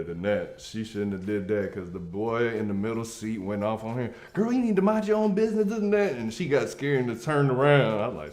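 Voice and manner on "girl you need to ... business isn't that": sassy telling-off. Retold or imitated. Boy goes off on her.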